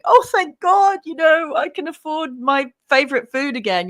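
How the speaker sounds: high, loud hammed-up gushing shopper; mock relieved customer on the news; mock tearful customer. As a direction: overplay a grateful shopper near tears. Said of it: "you'll have a customer crying".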